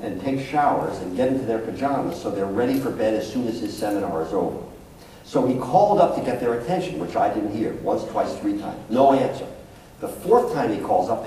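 ordinary delivery

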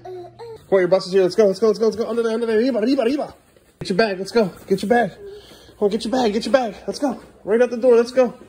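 high, excited rapid-fire urging; urgent hurrying. Real, not acted. Dad rushes son to the arriving bus, excited.

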